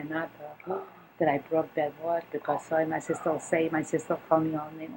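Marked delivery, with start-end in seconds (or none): none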